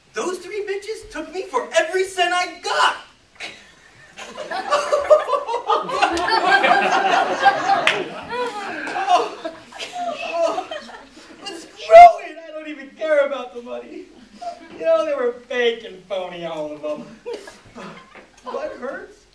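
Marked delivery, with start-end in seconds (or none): 0.1-3.0 loud, high-pitched shouted rant; furious outburst. Acted. Divorced producer raging about his three ex-wives, furious. 3.0-9.0 high, long hysterical howl; loud hysterical outburst. Acted. Divorced man losing it over his ex-wives, hysterical. 11.4-19.2 loud, worked-up bitter outburst; bitter ranting. Acted. Producer venting to an old flame about fake wives, bitter.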